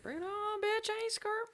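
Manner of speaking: high-pitched, soft play bravado; tiny-voiced mock tough talk; mock fighting challenge. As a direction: squeak out a pretend dare.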